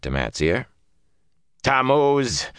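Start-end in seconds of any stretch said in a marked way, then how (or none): 1.6-2.5 higher, bitter naming of an enemy; spat like a curse. A narrator's reading. Woman naming the man who hunts her, like a curse. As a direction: spit the name out like a curse. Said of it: "as if it were a curse".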